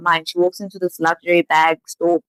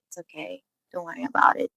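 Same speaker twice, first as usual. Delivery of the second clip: hushed, brushing-it-off reassurance; soft reassurance; 2 semitones lower, 9 dB quieter than usual. Retold or imitated. Sarah to Yeojin after her apology, softly reassuring. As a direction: wave the apology away very softly.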